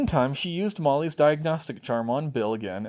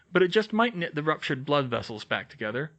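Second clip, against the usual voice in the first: ordinary delivery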